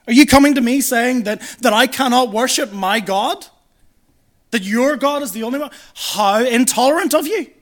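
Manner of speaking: imitating indignant challengers, high and loud; a bit offended. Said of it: "they start to get a little bit offended".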